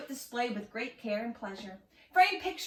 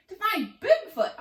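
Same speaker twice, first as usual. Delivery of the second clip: high and louder, bursting with eagerness; leaping excitement; 9 semitones higher, 7 dB louder than usual. A narrator's reading. Oscar eagerly answering the owl, excited and high. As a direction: blurt it out as you jump up. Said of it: "Oscar leapt as he said it".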